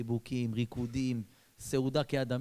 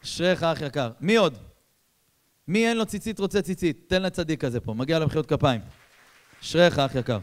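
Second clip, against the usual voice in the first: louder rousing call; calling out to crowd; 3 semitones higher, 8 dB louder than usual. Real, not acted. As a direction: call the next volunteer up. Preacher calls out to crowd. (קריאה רמה לקהל)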